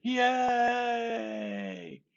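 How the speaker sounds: a very high, loud mock cheer; crowd cheer. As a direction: cheer like the whole village at once. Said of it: "And everybody's like".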